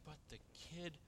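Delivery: ordinary delivery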